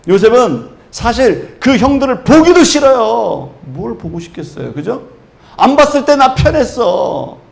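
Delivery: loud, high, impassioned preaching; full-force preaching. Acted. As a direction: preach it out, building with fervour. Preaching, voicing Joseph's refusal. (힘차게 외치는 설교)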